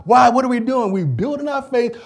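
ordinary talk

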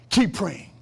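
ordinary talk

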